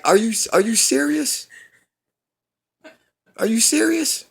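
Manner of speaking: high, disbelieving are you serious; incredulous. Real, not acted. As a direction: pitch jumps way up in disbelief. Incredulous rant at filmmakers.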